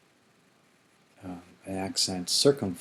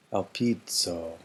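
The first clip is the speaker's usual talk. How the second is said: slow vocab read; slower than usual. Shown as a demonstration. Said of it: "reads vocabulary word".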